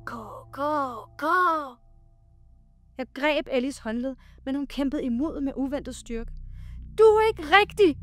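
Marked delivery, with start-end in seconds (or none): 0.0-1.8 dry, eerie chant, higher in pitch; ghostly chorus, pitched up and swelling; whispering voices. A narrator's reading. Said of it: "Hviskende stemmer eksploderede omkring mig som et kor af tørre stemmer". 7.0-8.0 high, loud defiant scream; high-pitched shout of denial. A narrator's reading. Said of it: "skreg jeg mod egetræet".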